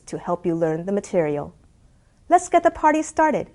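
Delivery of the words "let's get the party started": excited. Real, not acted. Upbeat course intro sign-off.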